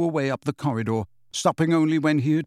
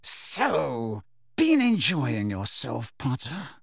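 menacing; slower than usual. A narrator's reading. Snape interrogating Harry, slow menace. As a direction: draw it out with icy restraint.

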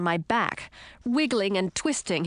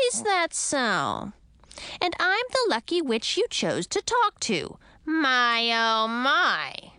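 high, drawn-out mock-sweet witch; sweetness fading; 4 semitones higher, slower than usual. A narrator's reading. Witch toying with captive royals, sweetness souring. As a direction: coo it with growing menace. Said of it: "her voice losing its sweet tone".

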